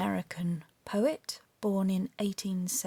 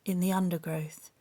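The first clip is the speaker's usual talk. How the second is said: reciting a favourite poem, hushed at the close; poem recitation; 4 dB louder than usual. Acted. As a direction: recite it like a treasured school poem. Recites Frost poem from memory.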